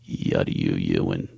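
very low, voiceless mumble; sister's code voice. Acted. Talking to his sister in their secret code, low grunt. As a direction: grunt it barely moving the jaw. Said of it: "We talk like this".

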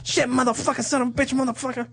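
a hint of a movie gangster cursing; Goodfellas impression. Repeating the gangster swearing bit for the co-host. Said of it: "That's my Goodfellas impression".

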